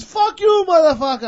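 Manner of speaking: loud, high mock cursing; retold angry retort. Cabbie curses the driver back, imitated angry yell.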